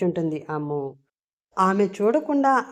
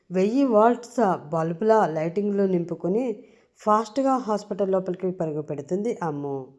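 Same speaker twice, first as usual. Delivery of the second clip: plain reading voice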